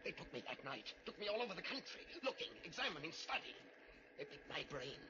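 urgent panic building to a cry; desperate. Acted. Professor begs John to shoot him, rising panic.

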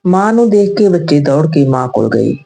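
plain reading voice

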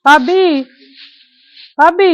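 loud call across the house, rising on the second; calling for sister-in-law, loud and high. Acted. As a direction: shout her name to find her.

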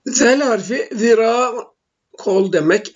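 ordinary talk

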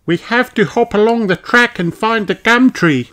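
put-on high eager child; excited high instructions; Kylie voice. Kylie explains the game to Peppa, high child.

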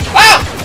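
short, high, loud yelp; startled scream. Real, not acted. Player ambushed while running, startled scream. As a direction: scream in sudden shock.

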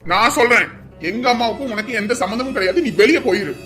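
loud furious order; angry shout. Acted. Son shouting at his sister to leave, furious. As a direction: throw her out with a yell.

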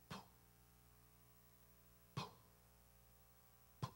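short, soft puff of a thump; imitated distant thud. Telling of noises at the basement, soft imitated thuds. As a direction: puff out a muffled bang. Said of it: "It sounded like somebody was trying to break".

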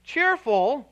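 "Cheerful." said high, loud and rising; stressed word. Shown as a demonstration. Preacher stressing the key word, high and rising. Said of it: "Cheerful is the first word".

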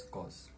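"cos" said "koss", one short syllable; dictionary pronunciation. Shown as a demonstration. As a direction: say "cos" like "cost" without the t. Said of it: "and is pronoun-, pronounced as".

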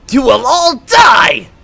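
slow, high, loud death threat; hammed-up villain shout, last word stretched. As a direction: draw out the threat loudly. Voicing Frieza in game.

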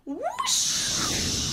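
high airy rising whoosh; whoosh sound. Acted. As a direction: swoop the sound up like a hoverboard. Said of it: "and go".

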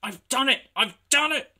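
high, loud jubilant cry; triumphant. A narrator's reading. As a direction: crow it in triumph. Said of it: "he puffed triumphantly".

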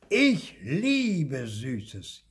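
high, long drawn-out cheer; jubilant. A narrator's reading. Marti cheers at the prospect of cake, jubilant. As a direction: whoop it slowly with delight. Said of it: "jauchzte Marti".

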